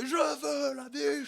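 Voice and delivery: high, slow shout from far off; distant yell. Retold or imitated. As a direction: yell it with an echo. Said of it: "vous l'entendez".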